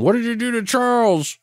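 high, frantic cry for his friend; distraught buddy. Retold or imitated. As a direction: yell it at the guy who hit him. Buddy shouts at the attacker.

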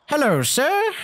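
squeaky-high, bright shop-clerk hello; high customer greeting. Pretend. Greeting the first customer at the pump, in a high clerk voice. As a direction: put on a high sales voice for the customer.

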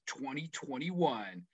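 ordinary talk

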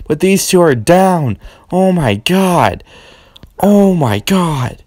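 normal talking voice

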